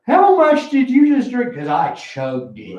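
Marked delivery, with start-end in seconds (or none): 0.1-1.6 much higher, louder alarmed question; startled friend. Retold or imitated. Friend shocked by how much he drank, much higher. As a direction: play the shocked buddy. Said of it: "He goes".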